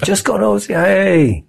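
high-pitched, playful sales pitch; breezy coaxing. Retold or imitated. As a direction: coax them as if it's nothing. Imitates own breezy pitch to newcomers.

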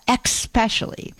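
"especially" mispronounced as "ek-specially"; wrong "especially" with X. Shown as a demonstration. Host showing listeners the error, "especially" said as "ex-pecially". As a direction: say "especially" with an X sound up front. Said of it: "The word is not especially".

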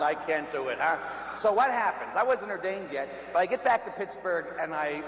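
ordinary talk

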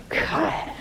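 laughed-out "God"; a real amused laugh on "God"; breathy laugh. Real, not acted. Priest laughing at the widow's spite, breathy laugh.

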